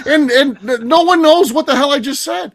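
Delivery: high-pitched, fed-up outburst; worked up at being ignored; exasperated rant. Real, not acted. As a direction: protest in real frustration, voice climbing. Exasperated at repeating the story.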